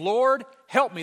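loud, high, slow plea; begging. Retold or imitated. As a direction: cry out for help as the kneeling beggar. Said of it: "There's that beggar term".

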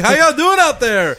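high, loud, drawn-out crowd greeting; mock stage host. Comic pretending to open a live show, loud stage-host call. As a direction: call out to an imagined audience.

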